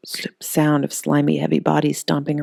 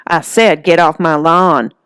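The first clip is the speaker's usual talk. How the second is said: louder, slow, every word hammered; yelling; 6 dB louder, slower than usual. Acted. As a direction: order the monster off your lawn.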